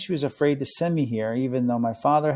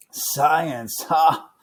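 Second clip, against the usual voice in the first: a scornful ha, louder; scoffing; 4 semitones higher, 3 dB louder than usual. A narrator's reading. God scoffing at the boy's faith in science. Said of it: "Mister D scoffed".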